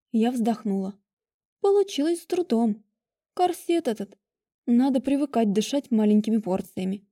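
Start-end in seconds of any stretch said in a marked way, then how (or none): none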